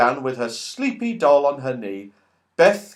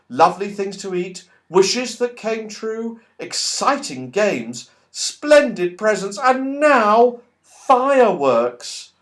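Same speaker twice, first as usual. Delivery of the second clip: happy; 8 semitones higher, 4 dB louder, slower than usual. Acted. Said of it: "said Beth happily".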